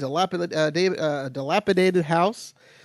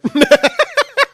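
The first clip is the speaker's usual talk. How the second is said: high burst of laughter; 9 dB louder than usual. Real, not acted. Laughs at own show name.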